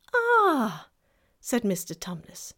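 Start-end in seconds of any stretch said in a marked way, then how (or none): 0.1-0.9 melancholy. Acted. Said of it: "in a rather melancholy voice".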